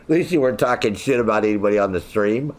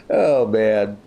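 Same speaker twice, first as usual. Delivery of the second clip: usual speaking voice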